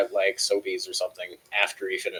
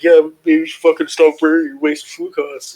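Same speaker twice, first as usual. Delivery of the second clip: loud, high mock rant; imitated scolding; 6 semitones higher, 8 dB louder than usual. Supervisor scolding cook over food costs, imitated anger. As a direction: imitate a boss laying into you.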